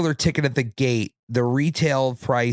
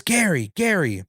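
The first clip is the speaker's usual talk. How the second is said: imitating a friend's dad calling a name, higher pitch; 6 semitones higher than usual. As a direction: call the name out, high then falling, without shouting. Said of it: "he starts calling me".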